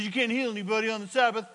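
fast, mocking legalistic tone; mocking rule. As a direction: rattle off the rule with scorn.

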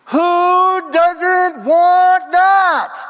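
high, drawn-out shouted question; climactic shout. Real, not acted. Preacher asking the congregation who could refuse, climactic shout. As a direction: cry it out very slowly at full force.

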